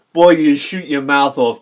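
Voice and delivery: usual speaking voice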